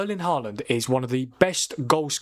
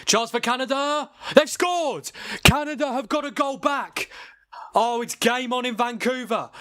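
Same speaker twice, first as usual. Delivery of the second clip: excited goal shout; 9 semitones higher, slower than usual. Real, not acted. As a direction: shout it like the ball just hit the net. Goal scored in live match.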